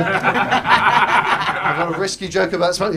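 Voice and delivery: smug slow expert aside; mock dictionary corner. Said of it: "just sort of like".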